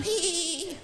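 put-on squeaky kid giggle; mock kid giggle; high giggle. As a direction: giggle like a little nephew. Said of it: "cracking up".

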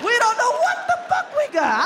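high, laughing exasperation; exasperated. Acted. Exasperated repeat of line.

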